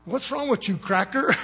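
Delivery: high-pitched joking imitation, breaking into laughter; Brother Durant. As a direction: playfully mimic a brother's teasing challenge. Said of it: "as Brother Durant say".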